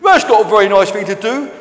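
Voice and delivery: loud, hurt dinosaur protest; indignant complaint. Acted. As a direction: scold whoever just hurt you. Hurt dinosaur scolds the orange stranger.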